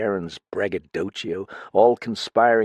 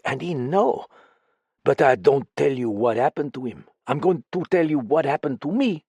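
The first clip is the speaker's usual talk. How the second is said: put-on higher, foreign-accented woman, fretful; frightened foreign woman confiding in a friend; lightly French-tinged voice, uneasy and pleading; accented woman character; 6 semitones higher than usual. Same character voice.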